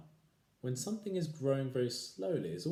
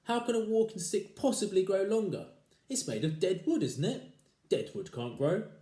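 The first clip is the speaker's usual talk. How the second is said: mocking husband; 10 semitones higher, 7 dB louder than usual.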